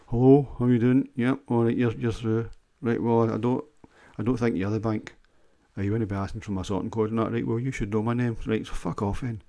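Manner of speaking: loud, bright telephone voice; phone voice. Shown as a demonstration. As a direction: put on the posh phone manner, then snap. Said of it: "my phone voice would be".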